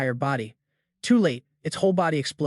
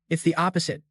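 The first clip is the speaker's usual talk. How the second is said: usual speaking voice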